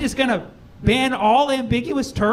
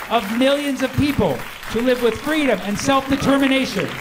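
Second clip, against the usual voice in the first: ordinary talk, nothing marked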